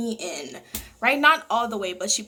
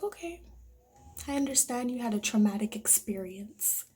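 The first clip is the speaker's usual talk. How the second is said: much softer, calm and matter-of-fact; calm dad; 2 semitones lower, 8 dB quieter than usual. Retold or imitated. Dad tells daughter to finish the lesson, soft calm voice. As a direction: brush it off gently like the dad. Dad calmly dismissing her ordeal.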